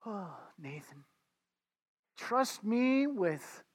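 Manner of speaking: slow, soft and tender, like a loving father; very gently. Retold or imitated. God reassuring a shaken Nathan, slow and gentle. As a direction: ease each word out gently, coaxing. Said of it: "And he said very gently".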